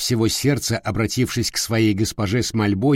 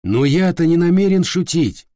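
higher, louder stern exclamation; exclaiming; 7 semitones higher, 3 dB louder than usual. Acted. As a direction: exclaim it firmly. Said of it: "воскликнул Дон Кихот".

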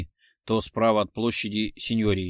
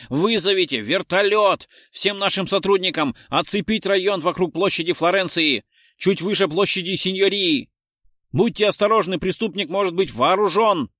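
loud, high, urgent commands; shouted orders; 7 semitones higher, 5 dB louder than usual. A narrator's reading. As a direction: commissioner barking orders to all units. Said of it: "крикнул Террачини". (громкие срочные приказы, на крике)

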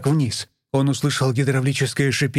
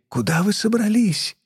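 hoarse voice; 8 semitones higher than usual. Acted. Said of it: "услышал он охрипший голос Елены".

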